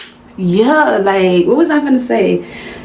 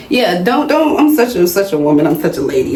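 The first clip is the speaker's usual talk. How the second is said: ordinary delivery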